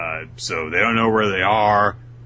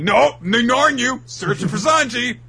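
high, loud curt brush-off; annoyed Chopper; 7 dB louder than usual. Acted. Chopper brushing off the juice dude, high loud snap. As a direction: snap it and move on.

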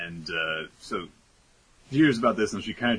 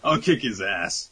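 Big Mom voice; 5 semitones higher, 7 dB louder than usual. Retold or imitated. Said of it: "She's just like".

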